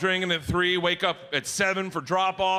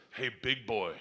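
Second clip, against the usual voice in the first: low, soft and knowing; sly greeting; 8 semitones lower, 7 dB quieter than usual. Retold or imitated. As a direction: drop it low and quiet, confidingly. Daughter greets dad oddly at breakfast.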